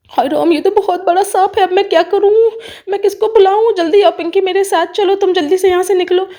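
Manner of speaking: high-pitched, urgent fretting; panicking aunt. Acted. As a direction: wail in alarm and hurry the child out.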